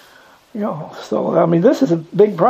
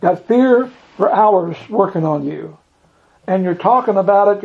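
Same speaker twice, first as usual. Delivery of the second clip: ordinary talk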